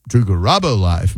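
Garabo with a swooping stress on RA; emphasis on the wrong syllable. Shown as a demonstration. Host testing his show greeting, oddly stressed Garabo. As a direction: lean hard into the RA of Garabo. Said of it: "putting the emphasis on the wrong syllable".

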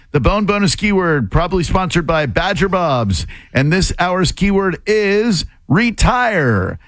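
upbeat contest promo read; radio announcer. Acted. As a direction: announce the contest prize with energy.

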